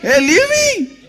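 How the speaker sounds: high-pitched mock scream for release; imitated screaming. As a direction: yelp it high, like someone being bitten. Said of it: "he's screaming".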